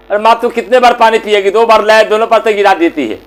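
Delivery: fed-up, mildly angry protest; exasperated son. Acted. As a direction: scold her in a rush. Son fed up with fetching water.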